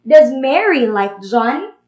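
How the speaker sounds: yes-no question, voice climbing on "John"; rising question tune through to "John"; rising intonation. Shown as a demonstration. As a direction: end the question with "John" going up. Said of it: "it has a rising intonation".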